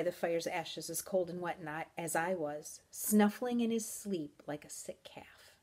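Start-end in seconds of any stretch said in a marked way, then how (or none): none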